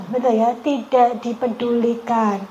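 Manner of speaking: usual speaking voice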